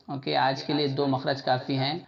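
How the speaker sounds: ordinary talk, nothing marked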